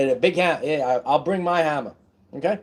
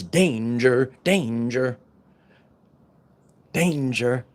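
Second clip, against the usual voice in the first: alarm voice; slower than usual. Retold or imitated. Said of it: "it says".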